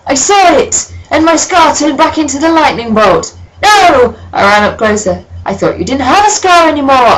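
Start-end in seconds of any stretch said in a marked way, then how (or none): 0.1-4.1 much higher, loud frantic cry; hammed-up alarm. Acted panic over the scar. 5.4-7.1 higher, slightly louder; mock shout. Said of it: "I shouted".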